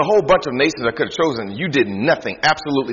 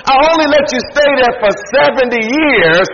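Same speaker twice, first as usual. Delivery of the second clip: raised, forceful preaching; preaching God's rebuke; 10 semitones higher, 6 dB louder than usual. Acted. Preacher voicing God's rebuke to Israel, forceful. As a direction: push it like a rebuke from the pulpit.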